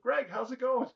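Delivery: high, loud imitated hello; delighted greeting. Storyteller acting out Robin greeting him on the street, delighted.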